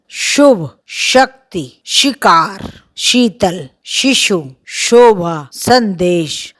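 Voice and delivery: each word starting श said carefully and sharply, with slight extra weight; sharp palatal sh words. Shown as a demonstration. Teacher reading a word list to students, careful and sharp. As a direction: give every श a sharp palatal hiss. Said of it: "इससे बनने वाले शब्द हैं".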